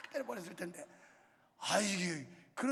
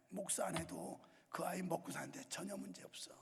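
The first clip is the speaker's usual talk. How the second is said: whispered aside; hushed, just between us; confiding whisper; 3 semitones lower, 6 dB quieter than usual. Real, not acted. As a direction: let the congregation in on a secret. Confiding aside about his son.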